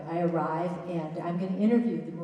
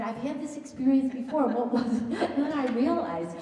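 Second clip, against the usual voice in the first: laughing; 7 semitones higher, 6 dB louder than usual. Real, not acted. Laughing realization of deja vu.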